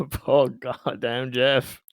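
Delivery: usual speaking voice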